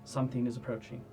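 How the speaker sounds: soft, suspenseful game master narration; ominous scene setting. Acted. Game master warns of approaching armor, hushed suspense. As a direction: let each phrase hang in long pauses.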